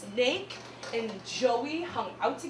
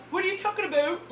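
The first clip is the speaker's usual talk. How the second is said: higher-pitched Canadian accent imitation; mock Canadian accent; 7 semitones higher than usual. Comic mimics Canadian TV teens, Canadian accent. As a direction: put on a Canadian 'aboot'. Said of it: "every time they were like".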